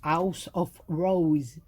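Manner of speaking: slow careful English accent on "House of Rose". Shown as a demonstration. Retrying the collection name for viewers, slow English accent. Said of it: "j'ai encore perdu l'accent anglais".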